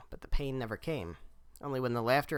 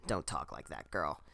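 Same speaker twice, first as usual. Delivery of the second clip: grandmother voice; 8 semitones lower, 5 dB quieter than usual. A narrator's reading. Grandmother scolding gently.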